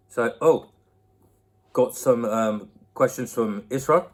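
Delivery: ordinary talk, nothing marked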